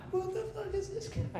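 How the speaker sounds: high, soft squeaky voice for the confused driver; mock confused driver. Comic driving prank, faking the baffled other driver, high and squeaky. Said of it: "I'm like".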